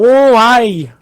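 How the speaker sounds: loud, high Scottish catchphrase; mock Scottish accent. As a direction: call it out in exaggerated Scottish. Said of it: "he puts a Scottish accent on".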